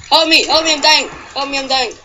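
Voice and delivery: yelling for help while fleeing; being chased. Pretend. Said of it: "being chased by a bunch of maniacs".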